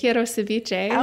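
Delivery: Quiero ceviche in a mildly Minnesotan Spanish; Minnesota Spanish. Shown as a demonstration. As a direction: try the Spanish line in a Minnesota accent. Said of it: "ceviche in Spanish".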